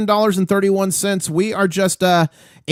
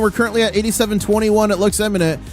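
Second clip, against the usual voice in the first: hyped market call, pitched up; live play-by-play; 5 semitones higher than usual. Acted. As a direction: call the price moves like a race.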